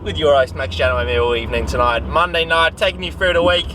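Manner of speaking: a bad Australian accent as a cheesy evening radio host; terrible Aussie accent. A mock Aussie DJ hosting his pretend radio show, in a badly faked Australian accent. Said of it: "Very terrible Aussie accent".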